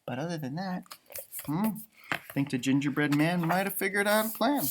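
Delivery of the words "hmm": usual speaking voice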